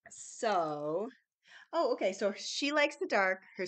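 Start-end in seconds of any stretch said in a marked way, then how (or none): none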